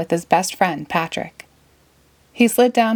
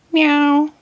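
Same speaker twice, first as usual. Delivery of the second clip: snail meow; 9 semitones higher, 4 dB louder than usual. Acted. Snail Gary speaks.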